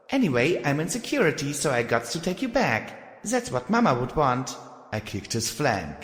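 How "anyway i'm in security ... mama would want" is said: high, stern filly voice; bossy young filly. Acted. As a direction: scold him, high and stern. Filly character voice.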